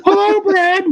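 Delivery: high, breathy flirty greeting; Muppet voice. Acted. Co-host voicing a Muppet in Fast Times, high flirty voice.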